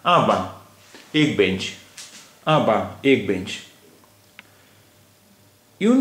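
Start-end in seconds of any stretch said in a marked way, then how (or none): 0.1-0.7 "आ बा" ending on an open nasal vowel, no C; silent final C. Shown as a demonstration. Teacher shows an unspoken last consonant, "आ बा" with no C. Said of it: "सी का तलफ़ुज़ नहीं हो रहा है". 2.5-3.0 "आ बा" ending on an open nasal vowel, no C; silent final C. Shown as a demonstration. Teacher shows an unspoken last consonant, "आ बा" with no C. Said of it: "सी का तलफ़ुज़ नहीं हो रहा है".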